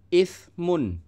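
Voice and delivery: teacher showing a thin tsa; th sound. Shown as a demonstration. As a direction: say Ismun as iθmun, tongue between teeth. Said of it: "Ini sa bukan sin ya, tapi sa jadi tipis".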